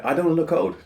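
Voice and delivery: ordinary talk, nothing marked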